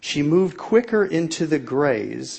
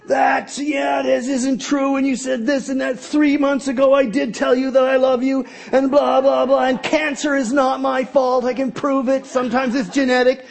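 higher, rushed protesting rant; mock indignant rant, higher; frantic self-defense; 10 semitones higher, 3 dB louder than usual. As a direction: defend yourself in a flood. His defensive unloading.

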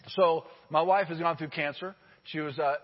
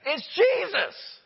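high, loud burst of awe; excited exclamation; 11 semitones higher, 5 dB louder, much slower than usual. Real, not acted. Preacher to congregation, a high awed burst. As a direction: exclaim it in wonder, drawn out.

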